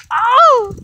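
sudden high "Oh!"; startled cry. Real, not acted. Vlogger nearly falling on the walk, sudden high cry. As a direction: catch yourself mid-slip. Slipping on the cold path.

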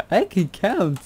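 high, loud self-congratulating cry; mock triumph. Builder celebrating getting the count right, mock-triumphant shout.

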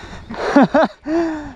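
high breathless laugh; relieved laugh. Real, not acted. Relieved after near crash.

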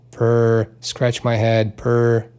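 usual speaking voice

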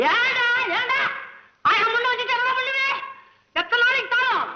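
high, wailing cry of despair; high-pitched comic lament; anguished wail. Acted. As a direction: wail it out high like a ruined man. Dharumi wails alone in despair.

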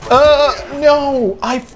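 high, loud outcry; raging gamer. Real, not acted. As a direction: yelp as you get killed. Sudden death in the game.

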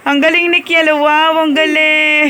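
drawn-out, delighted wow; excited praise. Real, not acted. Praising child's arm wrestling win. (masayang papuri)

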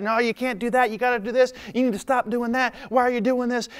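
high-pitched fretting of anxious onlookers; worried and upset. Retold or imitated. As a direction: imitate people nagging in alarm. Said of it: "getting all worried and upset".

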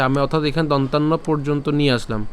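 ordinary delivery

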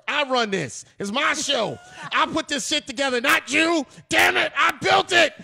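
building from loud to a shouting rant; angry. Pretend. Host mock rant about his show.

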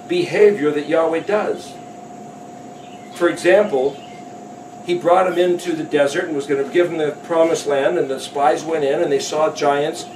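ordinary delivery

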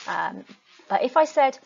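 ordinary talk

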